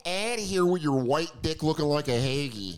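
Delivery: a put-on Philly accent, tough and dismissive; mock tough Philly woman brushing him off. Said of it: "in her Philly accent".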